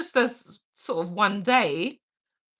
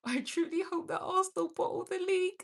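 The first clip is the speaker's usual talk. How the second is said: much higher, fast, snide mimicry of a rival fan; mocking squeaky voice; 11 semitones higher, 8 dB quieter, faster than usual. Reading a rival fan's comment aloud, mocking high squeak.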